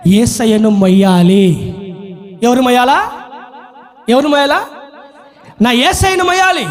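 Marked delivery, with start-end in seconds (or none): 5.6-6.7 high cry of devotion; impassioned Mary. Acted. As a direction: proclaim the longing aloud, in character. Acts Mary's cry, first take. (ఆర్తితో ఎలుగెత్తి)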